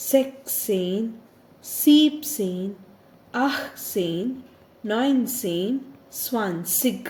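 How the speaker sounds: each German number said slowly and loudly; clear, loud teacher's count from Null to zwanzig; careful counting aloud. Shown as a demonstration. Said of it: "I'll just read".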